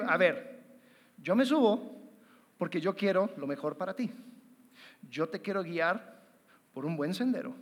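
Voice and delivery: usual speaking voice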